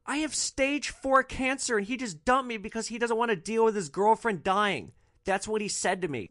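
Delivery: high, distraught wailing voice; retold explosive outburst. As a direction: imitate her pouring it out, pitch held high. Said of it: "And she just explodes".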